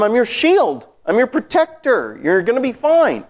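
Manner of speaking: God assuring Abraham he is safe; God reassuring. Retold or imitated. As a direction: declare it high and firm like God promising. Voicing God's promise again.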